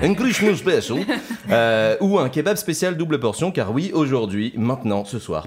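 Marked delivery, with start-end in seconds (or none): 0.0-1.0 put-on Christmas special laughed out in English accent; English accent breaking into laughter; laughing English accent. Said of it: "j'ai un accent anglais".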